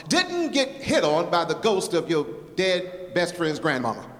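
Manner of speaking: rhythmic, rhyming poem performance; lively spoken-word recital for the congregation. Acted. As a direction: lean into the rhymes like a slam poet.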